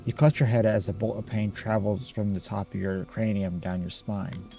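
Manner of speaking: usual speaking voice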